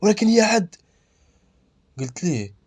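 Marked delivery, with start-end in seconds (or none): 0.0-0.8 shocked outburst, much higher and louder. Acted. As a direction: blurt it out loud in shock. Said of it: "بقى مصدوم وقال لي". (مصدوم، بصوت عالٍ)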